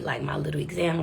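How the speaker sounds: ordinary talk, nothing marked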